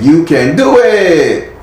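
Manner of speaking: put-on drawn-out, high Mexican-accented cheer. Reader relays Spirit's encouragement to a client in a Mexican accent. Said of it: "That's my best Mexican voice".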